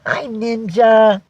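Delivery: high, slow, drawn-out hello to a pet; voicing a fan's greeting. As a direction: coo a greeting on someone's behalf. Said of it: "And Vicky says".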